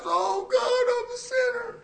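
very high, wailing mock sob; hammed-up altar cry, not meant; mock crying prayer. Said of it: "comes in the altars and cries".

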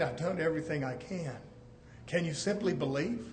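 soft, low reply; gentle God voice. Pretend. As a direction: answer gently, almost hushed. God answers softly.

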